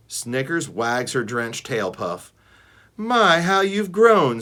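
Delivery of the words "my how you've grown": high, louder, slow fond voice; cooing old dog. A narrator's reading. Snickers doting on young Ruby, high and slow. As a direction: coo like a doting auntie.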